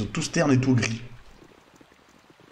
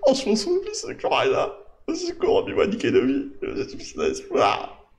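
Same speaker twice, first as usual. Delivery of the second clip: hammed-up despair; slower than usual. Hopeless villager laments his lost beloved, theatrically wailing. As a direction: overplay a hopeless villager breaking down.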